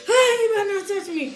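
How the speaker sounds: high-pitched mock threat; angry warning. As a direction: warn her off, half laughing. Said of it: "I'm getting angry".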